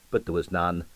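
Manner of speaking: ordinary delivery, nothing marked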